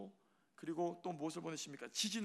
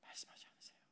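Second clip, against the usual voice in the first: whisper; 14 dB quieter than usual. Shown as a demonstration. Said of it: "말씀하지 않습니까?".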